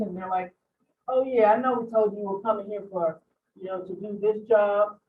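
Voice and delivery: ordinary delivery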